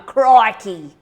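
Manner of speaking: loud, hammed-up Australian exclamation; broad Australian. Hamming up her Australian accent for viewers. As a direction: play up the Aussie accent. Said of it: "my Australian accent is coming out today".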